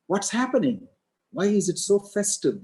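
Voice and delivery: high, puzzled mock wonder; puzzled Manthara. Old servant Manthara sees the city celebrating, high puzzled asking.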